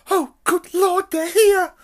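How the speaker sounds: a flustered aunt caught off guard; shrieking in a fluster; frantic. Acted. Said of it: "said Aunt Petunia frantically".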